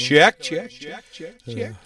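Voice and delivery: loud, high checks fading into echo; echoing mic check. Real, not acted. Host playing with the echo effect, loud checks trailing off. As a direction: shout it and let the echo die away.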